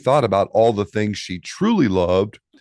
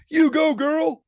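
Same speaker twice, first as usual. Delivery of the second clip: very high cheer; cheering grandparents. Acted. Grandparents cheering Poppy on again, very high. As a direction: squeal your support. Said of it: "said Poppy's grandparents".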